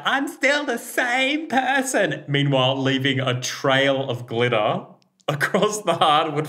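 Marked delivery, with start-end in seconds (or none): 0.0-2.2 much higher and slow, sing-song; flamboyant brother. Retold or imitated. Brother in glitter jeans, high and slow. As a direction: drawl it out like a proud diva. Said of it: "he'd be like".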